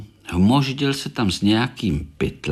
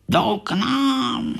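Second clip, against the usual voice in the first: slow, higher frightened gasp of words; startled; much slower than usual. Acted. Korálek spotting visitors coming, slow high fright. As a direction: draw it out high in sudden alarm. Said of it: "lekl se Korálek".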